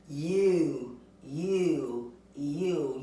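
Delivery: "U" said as a clear long you sound three times. Shown as a demonstration. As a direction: model the long "U" for kids. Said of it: "Do you hear that long U sound".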